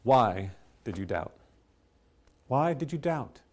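low, slow and soft, tender question; lovingly, tenderly. A narrator's reading. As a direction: ask it with gentle love, barely above a hush. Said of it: "said lovingly, tenderly".

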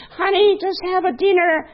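high, loud mock falsetto call; hammed-up shrieky call to the table; family member calling out. Voices family member calling to dinner.

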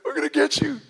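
high, whining imitation of a crying kid; mock crying. Younger brother threatening the older one, mock crying. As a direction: wail it like a furious little brother. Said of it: "I start crying".

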